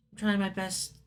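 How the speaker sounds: ordinary talk, nothing marked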